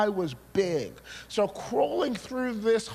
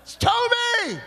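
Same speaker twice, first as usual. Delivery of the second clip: sudden shout; slower than usual. Retold or imitated. Preacher shouting his own name at the dream crowd, furious. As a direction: burst out in angry protest. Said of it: "And I'm shouting".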